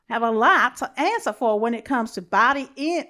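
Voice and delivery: loud, badly faked Valley Girl accent. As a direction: overdo a mock American Valley Girl. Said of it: "in my other American accent, which is Valley Girl".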